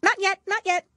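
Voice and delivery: high, loud hurried rabbit; hasty interruption. A narrator's reading. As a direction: cut in hastily, high. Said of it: "the rabbit hastily interrupted".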